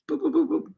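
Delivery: high little boop noises; four playful high-pitched boops. Pretend. Playful noise after free cup plug.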